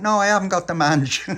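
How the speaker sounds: high, loud indignant protest; indignant denial. Acted. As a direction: shout it down, offended. Tony denies it, indignant.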